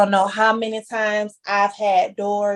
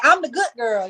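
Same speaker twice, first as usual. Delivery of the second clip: usual speaking voice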